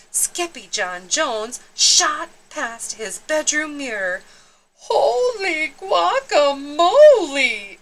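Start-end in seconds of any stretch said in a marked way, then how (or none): 4.9-7.8 high, slow, drawn-out cry of surprise; big startled shout; amazed exclamation. Acted. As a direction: gasp it out, astonished. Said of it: "exclaimed Skippy John Jones".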